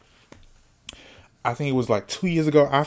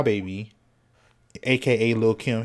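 usual speaking voice

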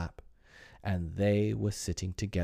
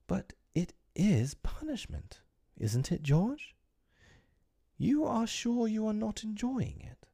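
gentle wife; 14 semitones higher than usual. Acted. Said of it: "wife gently probing".

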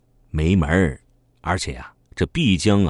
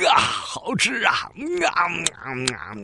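greedy eating; 12 semitones higher than usual. Acted. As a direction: savour every bite noisily. Thief gorging on stolen duck. (高声咂嘴，贪吃地大嚼)

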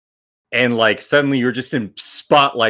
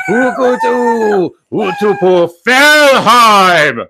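loud, high cheering chant; crowd screaming; 10 semitones higher, 7 dB louder, much slower than usual. Acted. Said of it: "crowd screaming in the background".

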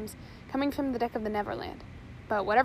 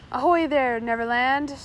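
loud hail across the water; shouted; 8 dB louder than usual. A narrator's reading. Smee hailing the other ship, shouting. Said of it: "shouted Smee".

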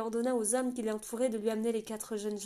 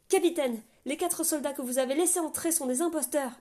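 out of breath; 5 semitones higher, 7 dB louder than usual. A narrator's reading. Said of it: "le souffle court".

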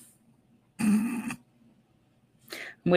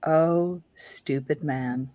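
low, slow, quiet sigh of disdain; Eddie Izzard impression; 3 semitones lower, 7 dB quieter, much slower than usual. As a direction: sigh it out slowly, like a weary comedian. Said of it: "to quote Eddie Izzard".

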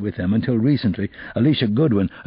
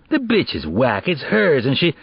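put-on defensive teen boy; 6 semitones higher than usual. Kevin blaming her.

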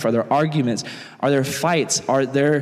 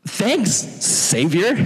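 unimpressed disciples; 4 semitones higher, much slower than usual. Acted. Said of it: "the disciples are looking at him".

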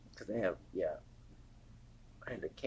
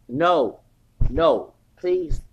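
firm refusal; 8 dB louder, slower than usual. Retold or imitated. Retold refusal to coworker.

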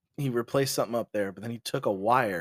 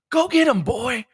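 high, loud shout at the dog; sic the dog; 11 semitones higher, 8 dB louder than usual. Retold or imitated. Homeowner shouting to send his Rottweiler at dad, high and loud. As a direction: yell the dog into attack. Owner sets dog loose.

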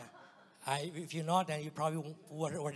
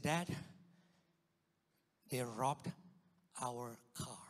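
ordinary talk

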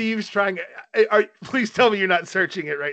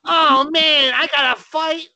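put-on high, whiny drunk kid voice; drunk Caillou voice; 10 semitones higher, 4 dB louder, much slower than usual. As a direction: play a drunk cartoon kid. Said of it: "drunk ass forty-year-old Caillou comes out".

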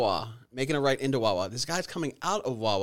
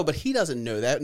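ordinary delivery

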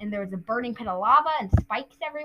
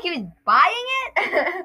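retold disbelief; 7 semitones higher, 5 dB louder than usual. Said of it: "And I was like".

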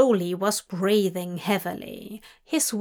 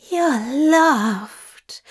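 raised, loud loving groan; 4 semitones higher, 5 dB louder than usual. A narrator's reading. Angel reassuring his demon lover, groaned out. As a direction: groan the words out with feeling. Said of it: "he groaned".

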